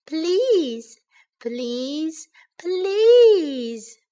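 high pleading that peaks last; begging. A narrator's reading. Baby bear pestering parents for a chair, high begging. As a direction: stretch the final one into a big plea. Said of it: "begged Baby Bear".

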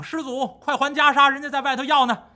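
calling out. Acted. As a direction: urge him loudly. Monks yelling at door. (大声嚷嚷催还袈裟)

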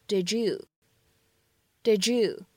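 "did you" blended so it sounds like "didju", the d and y merging; linked did you. Shown as a demonstration. Teacher showing students the d-y assimilation, blended "didju". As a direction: run "did you" together into one "didju" sound, twice. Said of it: "我们可以读成did you".

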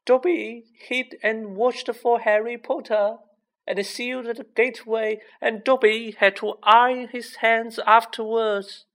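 high-pitched, earnest creature voice; confessing house elf. A narrator's reading. House elf explains blocking the gateway, earnest high voice. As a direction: keep it high and insistent.

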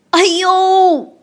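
突然大声的一声痛呼; 被咬一口疼得叫出来. Acted. 霸王龙被咬. (霸王龙大声喊疼)

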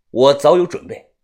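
ordinary delivery, nothing marked

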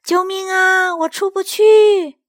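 high, slow, drawn-out cry for help; shouting for help. Acted. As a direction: shout it across the forest. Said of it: "那声音又叫了".

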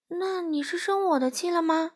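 plain reading voice

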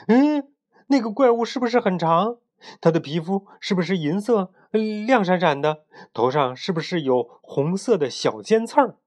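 high-pitched, eager story-character questions; excited questioning. Acted. Bintuo asks the others about the monster he spotted, excited.

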